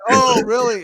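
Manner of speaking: hammed-up disbelief from Aaron; mock surprise. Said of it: "So Aaron was like".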